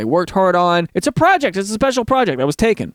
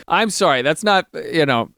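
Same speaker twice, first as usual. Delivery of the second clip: ordinary delivery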